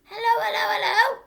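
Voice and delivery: high, loud squawky parrot voice; parrot calling. Acted. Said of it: "came the voice again".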